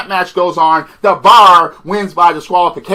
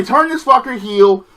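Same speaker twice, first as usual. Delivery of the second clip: ordinary talk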